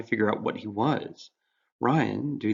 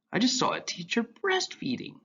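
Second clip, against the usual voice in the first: put-on higher, eager young voice; earnest teen boy; 14 semitones higher than usual. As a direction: play Ryan bright and earnest.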